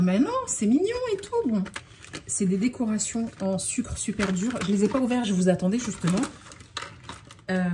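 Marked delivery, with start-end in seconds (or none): 0.0-1.5 high, sweet mock viewer voice; mock viewer reply. Vlogger mimicking a viewer defending the box, high and sweet. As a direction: parody a gushing follower. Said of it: "certaines vont me dire".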